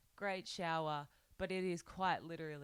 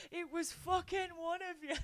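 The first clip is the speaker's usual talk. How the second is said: a hint of a rowdy British drunk, higher; lightly voiced accusing drunk; imagined drunk; 11 semitones higher than usual. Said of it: "thinking he was gonna go".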